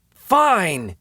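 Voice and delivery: high, exasperated robber; exasperated giving in. A narrator's reading. Robber relenting to the woman's plea, exasperated. Robber gives in to her pleading.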